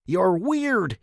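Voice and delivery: high, loud accusing yell; outraged dad shouting; father's scream. A narrator's reading. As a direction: yell it at the boy in disgust. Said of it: "he screamed at me".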